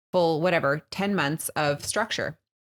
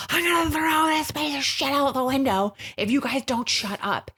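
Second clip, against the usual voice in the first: snapping yell; 9 semitones higher than usual. Retold or imitated. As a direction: lose it at the kids in the car. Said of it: "I snapped".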